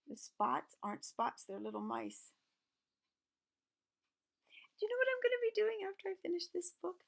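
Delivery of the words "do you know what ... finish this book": bright teasing high-pitched question; excited high question. Real, not acted. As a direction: rise way up, quick and eager. Excited question to child listeners.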